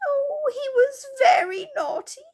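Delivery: high, loud sobbing little fairy. Acted. Silky sobs to the children about Moonface, high and tearful. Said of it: "said Silky".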